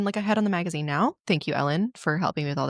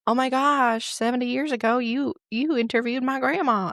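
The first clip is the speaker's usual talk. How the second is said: high, louder mock astonishment; acted wonder, pitched up high; amazed grandchild; 6 semitones higher, 3 dB louder than usual. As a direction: play an awestruck fan gushing. Imagined grandchild, decades later.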